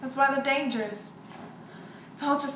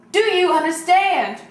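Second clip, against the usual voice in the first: louder and slower, stern; stern loud demand; 4 semitones higher, 8 dB louder, slower than usual. Acted. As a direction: bark it slowly, firm and loud. Mother repeats demand, louder.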